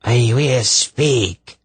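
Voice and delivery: high, drawn-out rasping surrender; harsh cry. Acted. Possessing sorceress gives in to Polgara, harsh cry. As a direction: give in slowly in a harsh, strained voice. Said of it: "the harsh voice cried".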